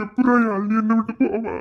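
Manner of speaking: put-on boy grieves that Yazhini left him; grieving cry. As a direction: cry it out in grief.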